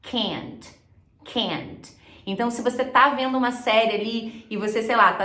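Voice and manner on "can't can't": softer, lower American can't, front vowel; American can't with the mouth pulled wide; American accent. Shown as a demonstration. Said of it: "sotaque mais americano".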